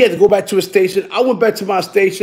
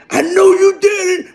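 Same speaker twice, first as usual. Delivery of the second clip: high, shrieking mock scream; screaming accusation; 11 semitones higher than usual. Coworker screaming accusingly at them, high mock shriek. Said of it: "She was screaming".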